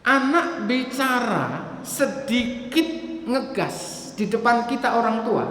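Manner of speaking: ordinary talk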